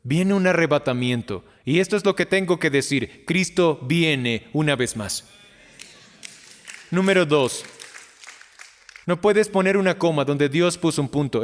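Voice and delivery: normal talking voice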